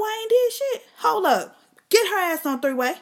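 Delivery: high, loud outrage; outraged yell. Acted. An innocent uncle, outraged and yelling at the accusation. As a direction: explode in disbelief. Imagined angry denial.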